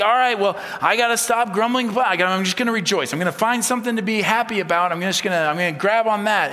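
high, loud, worked-up self-talk; forced resolve. Acted. Preacher acting someone determined to stop grumbling, loud forced resolve. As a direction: talk yourself into being happy, hammed-up.

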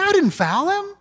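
high mock whine of innocence; whining player. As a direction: plead your innocence in a high hammed-up whine. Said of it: "they go to the referee".

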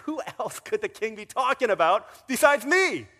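loud, high, delighted with himself; smug gloat. Retold or imitated. Preacher voicing Haman sure the king means him, smug gloat. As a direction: savour your own importance. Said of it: "it's like".

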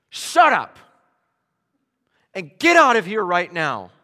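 forceful command. Acted. Jesus ordering the demon out, a forceful shout. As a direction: bark the order with full authority. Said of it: "equivalent of Jesus saying".

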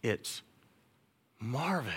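gently calling a name, very soft. Acted. God quietly calling someone's name, very soft. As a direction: call the name like a quiet inner voice.

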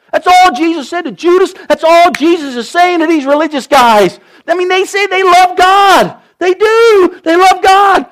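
high, loud fervent preaching; impassioned sermon climax; preaching at full force. Acted. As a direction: lift it up and drive it home to the congregation.

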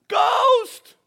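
hammed-up terrified shriek; flipping out. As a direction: scream it loud and high, as if seeing a ghost. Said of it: "and they're flipping out more".

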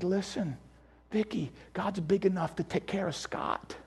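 hushed, low, tender voice; quietly calm a crying wife, as retold; gentle reassurance.

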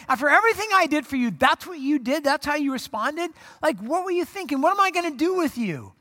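high, exasperated mock telling-off; indignant scolding. Imagined indignant scolding of Peter.

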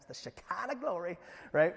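Shekinah glory in a drawn Southern accent, "Shekinah" as "Sha-kah-na"; playful Southern accent on Shekinah glory. Shown as a demonstration. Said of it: "can't really say without using a Southern accent".

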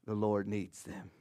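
mock mind trick line. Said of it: "not like a Jedi mind trick".

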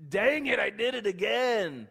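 exasperated at repeating the mistake; frustrated outburst. Acted. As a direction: blurt out annoyance at yourself. Peter catching his own slip.

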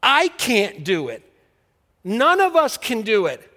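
slow and loud, hammer each line home; the preacher shouts over the congregation; preaching at full force. Acted.

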